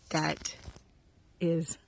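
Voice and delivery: halting, choked-up delivery; a little emotional. Real, not acted. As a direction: falter with emotion, then push the words out. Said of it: "I get a little emotional".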